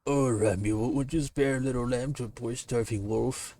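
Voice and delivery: fawning hungry wolf; wheedling wolf. Acted. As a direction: beg sweetly like a hungry wolf. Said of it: "Dramatic reading".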